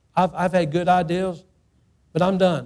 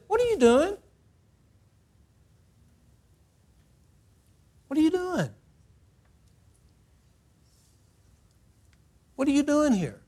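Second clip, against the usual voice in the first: high, searching voice of God calling out; 8 semitones higher, 3 dB quieter than usual. Acted. God confronting a rebel, high and piercing. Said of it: "and God said".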